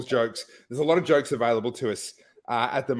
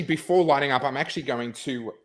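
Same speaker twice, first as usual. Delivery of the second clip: normal talking voice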